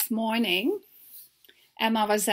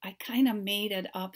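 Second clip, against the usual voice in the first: ordinary delivery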